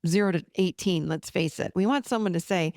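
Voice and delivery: ordinary talk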